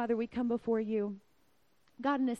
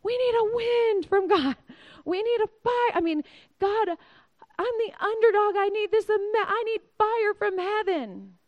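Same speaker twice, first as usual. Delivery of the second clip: much higher, louder, frantic begging; desperate plea; 9 semitones higher, 7 dB louder than usual. Pretend. A believer in crisis begging God, loud and frantic. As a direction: beg God for a miracle, voice straining high.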